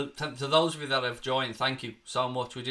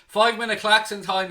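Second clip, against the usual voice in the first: excited announcement; 7 semitones higher, 9 dB louder than usual. Real, not acted. Streamer announcing the klaxon.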